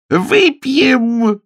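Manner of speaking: very high, loud, stretched cry; high-pitched rallying yell; joyful shout. A narrator's reading. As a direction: whoop it out as a toast. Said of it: "закричал Натти".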